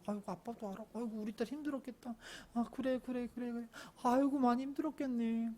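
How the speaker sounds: tender, hushed empathy; soothing father. Acted. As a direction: comfort an upset daughter with gentle sighing sympathy. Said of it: "모든 걸 다 들어줘요".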